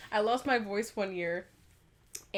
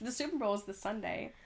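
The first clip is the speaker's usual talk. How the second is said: sassy; 7 dB quieter than usual. Acted. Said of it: "I don't know why I said it like that".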